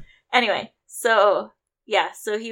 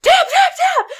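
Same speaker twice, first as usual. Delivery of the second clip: much higher, loud repeated shouts; retold yelling; 12 dB louder, slower than usual. Coaches yelling at a choked fighter to tap, loud frantic shouting. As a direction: bellow it at the trapped fighter. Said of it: "all the coaches and I are like".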